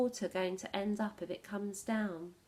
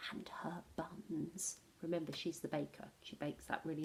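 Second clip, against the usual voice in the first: hushed whisper; 5 semitones lower, 9 dB quieter than usual. A narrator's reading. Hushed narration aside.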